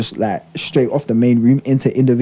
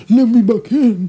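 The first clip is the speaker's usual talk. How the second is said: high, slow whining plea; mock whiny plea; 8 semitones higher, much slower than usual. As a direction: whine it like a kicked-out drunk. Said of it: "I bet you he was like".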